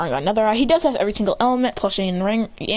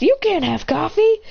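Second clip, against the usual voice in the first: higher-pitched scolding yell; mock yell; 7 semitones higher, 3 dB louder than usual. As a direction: scold him in a raised pitch. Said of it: "Kashi yells".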